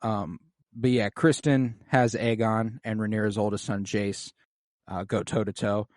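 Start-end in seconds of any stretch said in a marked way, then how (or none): none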